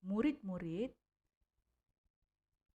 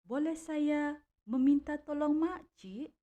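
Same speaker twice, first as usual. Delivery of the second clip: high, louder polite question rising at the end; rising question tune; 7 semitones higher, 7 dB louder than usual. Shown as a demonstration. Teacher models the third example question, high rising tune. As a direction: ask politely, voice climbing high before the stop. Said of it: "menggunakan intonasi nada suara yang agak tinggi".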